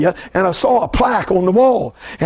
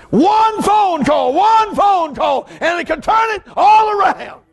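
high, slow, shouted preaching; preaching at full force; 10 semitones higher, slower than usual. Real, not acted. Preacher shouting hope to the congregation. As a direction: drive it home to the church.

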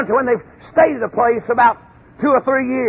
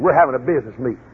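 fast, lower dismissive answer; quick low reply; 11 semitones lower, faster than usual. Retold or imitated. A spy brushing off Caleb and Joshua, low and fast.

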